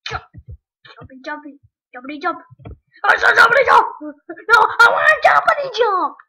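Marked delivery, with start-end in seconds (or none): 3.0-6.2 high, loud outburst; insistent shout. Real, not acted. Gamer demanding his character's jump, insistent shout. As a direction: yell the demand at the game.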